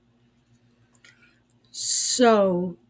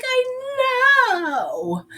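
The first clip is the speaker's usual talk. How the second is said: smug agreement; 3 dB louder than usual. Retold or imitated. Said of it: "And then Megan was like".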